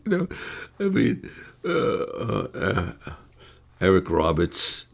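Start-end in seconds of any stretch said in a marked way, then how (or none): 0.0-2.9 lightly voiced stoned mumbler with halting fillers; Christopher Walken. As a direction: imitate someone stoned out of his mind. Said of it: "Christopher Walken ... looked like he was stoned".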